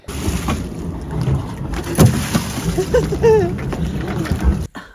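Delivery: high breathless laughter; helpless laugh. Real, not acted. Laughing at the scramble.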